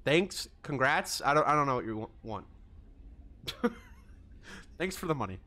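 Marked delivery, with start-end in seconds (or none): 2.8-4.1 high airy squeal of laughter; squeaky breathy laugh. Real, not acted. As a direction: let out a squeaky giggle.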